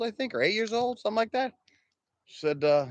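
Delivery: normal talking voice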